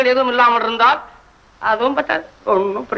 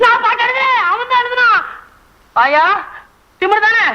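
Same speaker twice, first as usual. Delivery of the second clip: wailing denial; 10 semitones higher than usual. Acted. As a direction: cry out that he is no poet. Poet wails denial.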